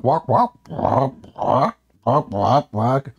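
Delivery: repeated croaking like a frog; mock frog croak chant. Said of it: "they keep repeating like a frog".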